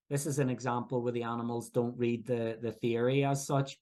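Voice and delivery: normal talking voice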